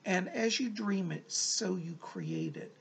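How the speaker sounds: normal talking voice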